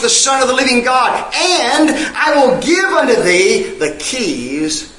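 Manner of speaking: usual speaking voice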